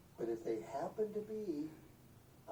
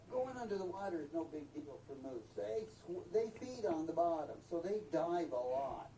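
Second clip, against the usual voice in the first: normal talking voice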